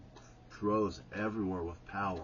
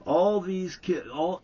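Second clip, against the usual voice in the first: ordinary talk, nothing marked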